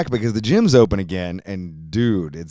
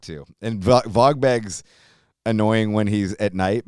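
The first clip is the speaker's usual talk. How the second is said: normal talking voice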